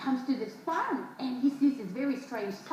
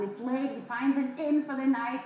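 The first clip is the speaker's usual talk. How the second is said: usual speaking voice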